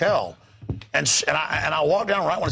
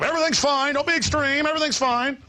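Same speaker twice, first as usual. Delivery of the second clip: mocking placating voice; 10 semitones higher than usual. Mocking the dismissive public.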